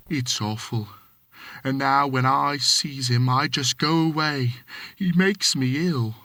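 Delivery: lightly voiced Cockney manservant, deferential; a hint of London Cockney, polite to his master; Cockney servant. Thomas answering his employer, light Cockney.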